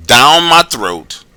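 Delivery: very slow, drawn-out words; stretched-out retelling. Retold or imitated. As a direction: draw it out like a slow slide. Acting out finger sliding down.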